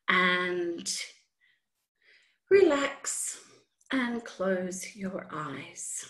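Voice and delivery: soft, slow meditation voice; guided meditation. Acted. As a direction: ease them into calm. Leads a meditation.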